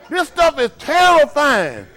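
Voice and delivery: high, loud, drawn-out comic wail; mock terror. Kid dreading a delayed whipping, mock terror. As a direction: stretch it out in hammed-up panic. This stuff is terrifying.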